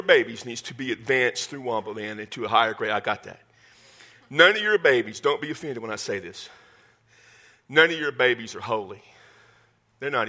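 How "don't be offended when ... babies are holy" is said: ordinary delivery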